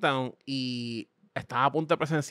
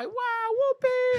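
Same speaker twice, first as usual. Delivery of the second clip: much higher, very slow drawn out whoopee; carousel rider glee; much slower than usual. Retold or imitated. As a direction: stretch it out like a kid on a merry-go-round. Mocking riders circling on horses. (voz muy aguda y lenta de alegría burlona)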